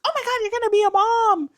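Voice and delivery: high-pitched excited squeal; mock shriek of joy; imitated excited friend. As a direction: squeal it like a thrilled best friend. Imitates friend's excited reaction to news.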